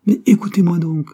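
high, loud demand to be heard; insistent protest. A narrator's reading. Clerk protesting to squabbling colleagues, insistent.

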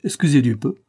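mock-impressed sarcasm; sarcastic. A narrator's reading. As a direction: toss it back with mild irony. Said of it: "fit Oliver d'un air sarcastique".

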